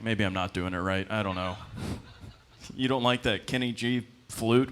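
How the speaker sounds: normal talking voice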